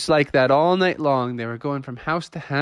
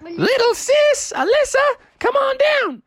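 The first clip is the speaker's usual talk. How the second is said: high, loud parent calling upstairs; 3 dB louder than usual. Acted. Mom calls Froggy and sister downstairs, calling out. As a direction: holler for the kids with stretched-out words.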